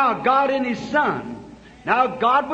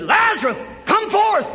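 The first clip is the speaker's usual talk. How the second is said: commanding call; 8 semitones higher than usual. Retold or imitated. Jesus calling Lazarus out of the tomb, commanding shout. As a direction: call the dead man out of the grave.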